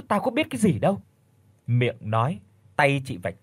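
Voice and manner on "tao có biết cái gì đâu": high-pitched mocking echo of mother's words; mocking her mother. Daughter mocks her mother's excuse: high, sarcastic echo. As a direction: parrot the excuse back, much higher.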